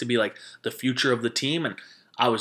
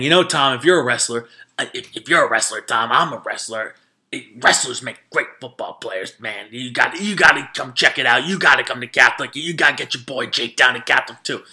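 lightly imitated coach, pushy and louder; recruiting pitch; 5 dB louder than usual. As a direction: press him like an eager recruiter. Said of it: "gives Tom a recruiting pitch".

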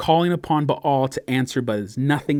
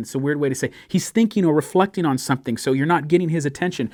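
usual speaking voice

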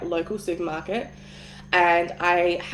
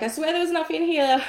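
flustered excuse; 9 semitones higher than usual. Retold or imitated. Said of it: "I was just kind of the girl".

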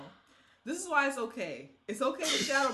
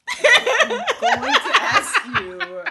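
laughing exasperation; 15 dB louder, much slower than usual. Real, not acted. Mock outrage at friend's opinion.

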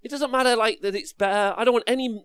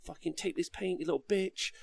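imitated rage, muttered much softer under the breath; mock rage; 13 dB quieter than usual. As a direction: grumble the insults at the model, much softer. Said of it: "and just rage through it".

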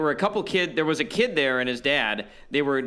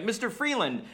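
put-on kid calling out; 9 semitones higher than usual. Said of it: "this kid was like".

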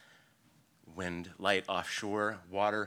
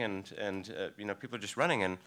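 normal talking voice

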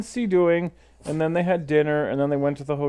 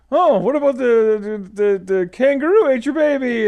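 imitating co-host; 6 semitones higher, 4 dB louder than usual. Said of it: "She's like".

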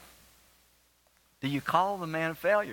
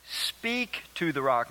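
"Speak to the rock." slow, stress landing on "Speak"; emphasized slowly; slower than usual. Shown as a demonstration. Pastor recalling the emphasized command, slow and stressed. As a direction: drag out "Speak" deliberately. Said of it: "pause there and emphasize that".

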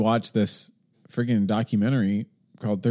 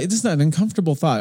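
ordinary talk

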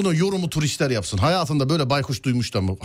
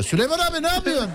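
high-pitched call; 11 semitones higher than usual. Acted. Calls out to the lion.

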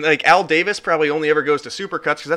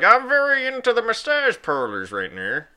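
Robert Kraft impression; 7 semitones higher than usual. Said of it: "this is my best Robert Kraft impression".

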